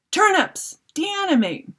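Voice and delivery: high, loud command; crying out. Acted. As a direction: cry out the spell. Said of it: "I cried in mage speak".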